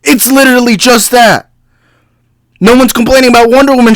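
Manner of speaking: fired up, pitch climbing; worked-up fan ranting; heated rant. Real, not acted. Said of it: "I'm sorry if I'm yelling".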